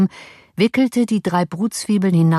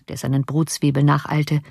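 usual speaking voice

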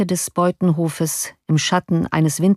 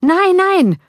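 much higher and louder angry outburst; furious; 14 semitones higher, 6 dB louder than usual. A narrator's reading. Enraged man answers the street crowd, furious high shout. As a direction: hurl the no at them in rage. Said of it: "wiederholte der wütende Mensch".

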